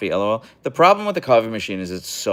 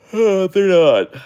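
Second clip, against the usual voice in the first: slow, drawn-out high resignation; emphatic sigh; 12 semitones higher, 10 dB louder, much slower than usual. Real, not acted. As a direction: stretch it out, resigned. Sighs, agrees huskies not cuddly.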